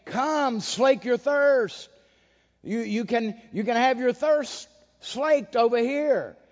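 lightly voiced preacher selling water to the desert; mock preacher of water. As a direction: beckon the thirsty like a carnival preacher, a touch hammed. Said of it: "and saying".

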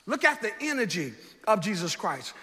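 ordinary delivery

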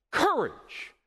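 high, punched COURAGE; sudden ringing preacher's cry. Acted. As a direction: call out COURAGE as a command. Preacher repeats Jesus' cry.

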